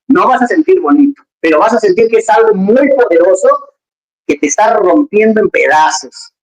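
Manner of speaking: normal talking voice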